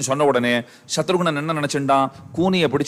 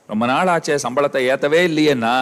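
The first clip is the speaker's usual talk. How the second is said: ordinary talk, nothing marked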